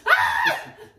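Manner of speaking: very high loud laugh; shrieking laugh. Real, not acted. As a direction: squeal with laughter.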